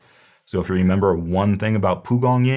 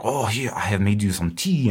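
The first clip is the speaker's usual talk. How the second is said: mock French host. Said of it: "some French guy being like".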